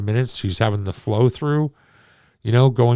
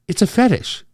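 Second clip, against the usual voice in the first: lightly voiced girlfriend; 9 semitones higher than usual. Girlfriend's matter of fact answer.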